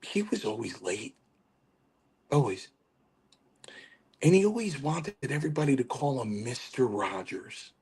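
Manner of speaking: hushed retelling with long pauses; Chief. Retold or imitated. Chief lecturing the teller on lateness, lightly imitated hush. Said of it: "He goes".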